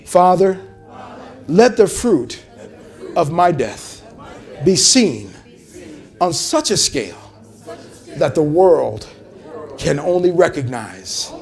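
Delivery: slow, phrase by phrase prayer for the church to echo; call-and-response prayer. Shown as a demonstration. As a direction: lead the prayer in short lines. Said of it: "Say this with me today".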